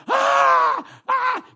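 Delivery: high loud shrieks, imitated; demon's scream. Preacher imitating the demon screaming in terror, high loud shrieks. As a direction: let out two piercing demon screams. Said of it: "Ele gritava assim".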